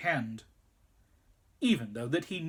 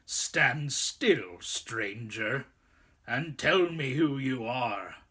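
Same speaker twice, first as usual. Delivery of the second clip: growling wolf; slower than usual. A narrator's reading. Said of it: "said in a growling voice".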